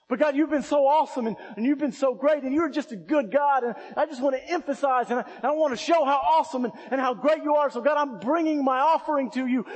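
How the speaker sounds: worshiper's praise prayer. Retold or imitated. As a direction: gush your praise quickly at first.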